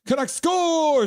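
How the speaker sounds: high, slow, stretched goal cry; mock goal call. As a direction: call it out like a hammed-up announcer. Reading a chat goal call.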